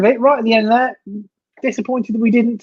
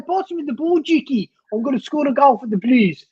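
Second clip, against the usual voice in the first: mock South African, pitched up; South African accent; 3 semitones higher than usual. Shown as a demonstration. Co-host teasing Stephen about McGree, South African accent. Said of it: "he'd talk like that and say".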